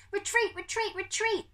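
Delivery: high, urgent repeated cry; screamed retreat. Acted. Pickering punching Humphrey's head, screaming to retreat. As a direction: scream it in panic. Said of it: "Pickering screamed".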